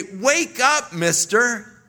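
loud, high-pitched exhortation; urgent wake-up call. Acted. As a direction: call out to rouse someone who cannot see the danger.

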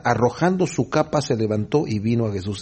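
ordinary talk

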